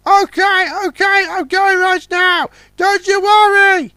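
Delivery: very high curious train voice; little train Puffer. Acted. Puffer asks Big Mac, high and curious. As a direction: ask in the chirpy engine voice.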